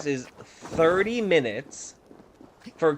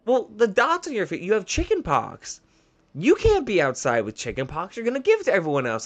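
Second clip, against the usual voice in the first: higher-pitched imitation of a child telling off an adult; mock childish insistence, pitched up; retold scolding kid; 7 semitones higher, 4 dB louder, faster than usual. Kid tells teacher he has chicken pox, imitated scolding child.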